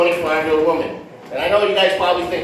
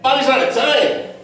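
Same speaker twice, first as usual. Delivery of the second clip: a higher, louder Italian grandmother; grandmother's voice, higher; 7 semitones higher, 3 dB louder than usual. Retold or imitated. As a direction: pitch it up like an old Italian nonna. Grandmother's reply.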